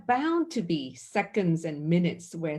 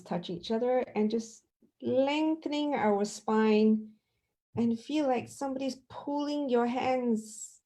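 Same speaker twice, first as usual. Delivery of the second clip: guided stretching; 3 semitones higher than usual. Acted. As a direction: ease them upward, unhurried. Leads stretching practice.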